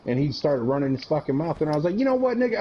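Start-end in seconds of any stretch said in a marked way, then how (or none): 2.0-2.6 much higher, heated challenge; escalating yell. Retold or imitated. Soldier challenging a sergeant to fight, yelling high. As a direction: ramp up into a challenge.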